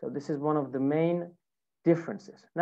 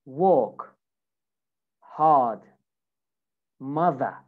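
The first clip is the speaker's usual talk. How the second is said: British "walk, hard, mother" with R silent; teacher's spaced-out British demo of "walk, hard, mother"; British accent; 2 semitones lower, much slower than usual. Shown as a demonstration. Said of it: "British version".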